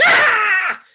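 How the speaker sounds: sudden loud high screech; attacking cat screech. Acted. Wee cat leaps at its master, loud high screech. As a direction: shriek like a cat pouncing. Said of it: "the wee cat kinda went".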